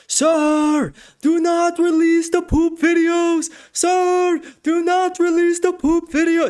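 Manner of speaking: high, strained mock Indian accent. Mocking a commenter in a put-on Indian accent. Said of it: "I gotta read this in an Indian voice".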